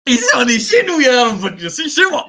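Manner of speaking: loud, high mocking preacher voice. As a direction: parody him, loud and high. Mocks preacher's line loudly.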